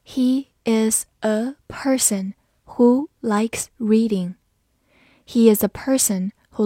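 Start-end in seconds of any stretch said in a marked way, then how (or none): 0.0-4.4 very slow, careful reading. Shown as a demonstration. Teacher reading the sentence slowly for students. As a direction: drag each word out for learners. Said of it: "好，慢慢来读。".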